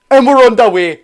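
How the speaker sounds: loud, high, thrilled announcement; big kickoff shout; excited kickoff call. Real, not acted. Kickoff call on live watchalong.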